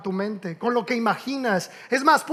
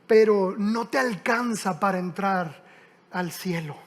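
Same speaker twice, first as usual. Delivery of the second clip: gentle Jesus; 5 semitones lower, 5 dB quieter, slower than usual. Retold or imitated. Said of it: "Y Jesús le contesta".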